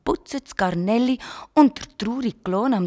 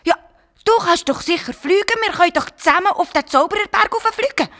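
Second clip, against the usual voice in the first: put-on high, quick excited child; eager urging; 9 semitones higher, 6 dB louder, faster than usual. Garnelli urges the dragon to fly, high and eager. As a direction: bubble over with the idea.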